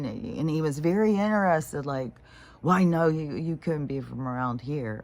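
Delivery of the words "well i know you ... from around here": normal talking voice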